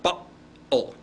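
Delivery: Cockney "Bottle" with a glottal catch in place of the t; Cockney glottal stop. Shown as a demonstration. Teacher repeating a Cockney example for students, "Bottle" said with a glottal stop. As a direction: drop the t of "Bottle" into a catch in the throat. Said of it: "did you hear that glottal stop".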